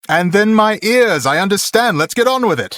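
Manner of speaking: high, impatient villain, stretching the words; Chris Sarandon. Acted. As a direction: play an exasperated, haughty prince. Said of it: "I can't really do Chris Sarandon".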